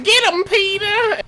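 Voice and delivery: high, drawn-out cartoon cheer; cartoon wife. Cartoon wife urging husband on, high drawn-out falsetto. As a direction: egg him on in a slow falsetto. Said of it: "It's like".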